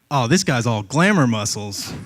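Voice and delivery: smug like a fighter sizing you up; lightly voiced cocky pit bull; cocky dog voice. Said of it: "the dog's gonna ... be like".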